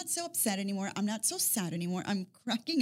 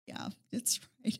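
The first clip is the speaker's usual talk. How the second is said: whispered; 6 dB quieter than usual. Retold or imitated. Retold admitting to dad.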